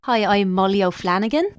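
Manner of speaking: put-on Dublin accent; Irish accent. Guest introducing herself to the doctor, Irish accent. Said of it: "where's that accent from?".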